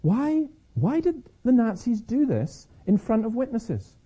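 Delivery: lightly voiced Schindler asking himself, drawn out; Schindler wondering. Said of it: "Keneally has Schindler wondering".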